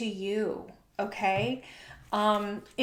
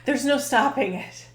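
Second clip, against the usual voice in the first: laughing; 3 semitones higher, 6 dB louder than usual. Real, not acted. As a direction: laugh as you say it. Amused remark about childbirth.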